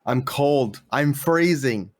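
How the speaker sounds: loud, whiny complaint. Real, not acted. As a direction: complain loudly about the cold. Complaining about the cold.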